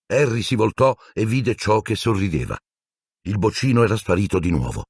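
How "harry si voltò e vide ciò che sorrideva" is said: plain reading voice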